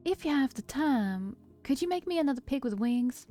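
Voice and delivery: attempted country accent. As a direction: ask hesitantly in a lilting country accent. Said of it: "country accent that I was trying to do".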